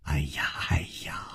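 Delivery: very soft, whispered tut-tutting; amused soothing sigh. A narrator's reading. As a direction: sigh it out under the breath. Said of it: "无花微笑着说".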